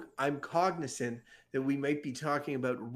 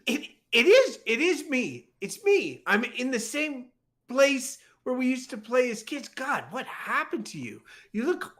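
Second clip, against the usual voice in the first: 1 excited reunion; 8 semitones higher than usual. Acted. Old friends reunite.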